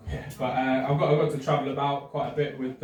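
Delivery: usual speaking voice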